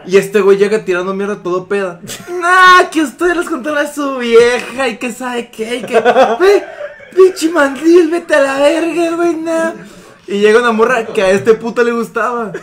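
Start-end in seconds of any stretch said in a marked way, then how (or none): 2.3-9.7 hammed-up slurred drunk yelling; high drunken taunting shout; drunk rant. Drunk friend ranting at couple.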